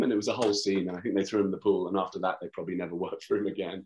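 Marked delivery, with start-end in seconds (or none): none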